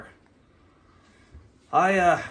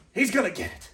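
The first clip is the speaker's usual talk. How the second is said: muttered threat; 2 semitones higher, 5 dB quieter than usual. Acted. Threatening Spanky to the camera, a softer muttered threat. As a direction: say it under his breath first.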